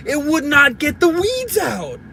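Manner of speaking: high, emphatic exasperation; exasperated. Real, not acted. Ranting about the useless edger, exasperated. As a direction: raise the pitch and stress each word.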